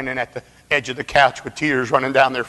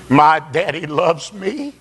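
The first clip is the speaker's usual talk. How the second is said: preaching climax; 10 semitones higher than usual. Acted. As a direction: build to a ringing cry. Preaching climax voicing boy.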